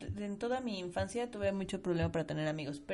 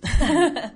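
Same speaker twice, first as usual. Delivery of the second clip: loud laugh; 9 semitones higher, 14 dB louder than usual. Real, not acted. Laughing after agreeing warmly.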